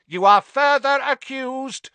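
loud, high-pitched roar of accusation; bellowing. A narrator's reading. Crouch accuses his shrieking son, bellowing. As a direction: bellow over the screaming prisoner. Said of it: "bellowed Mister Crouch".

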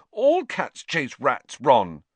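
mildly distressed pleading; voice shaking. A narrator's reading. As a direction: plead slowly, a touch unsteady. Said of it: "her voice shaking".

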